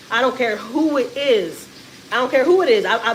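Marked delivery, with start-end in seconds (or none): 0.1-1.7 slow deliberate. Real, not acted. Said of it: "repeated for emphasis". 2.1-2.8 louder insistence. Real, not acted. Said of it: "repeated for emphasis".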